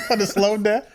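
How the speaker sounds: high, loud laughing question. Real, not acted. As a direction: laugh the words out. Laughs at co-host's point.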